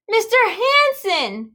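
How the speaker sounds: high, slow call. A narrator's reading. Sam calling to Mister Hanson from the porch. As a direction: call to the neighbor across the yard. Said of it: "Sam called".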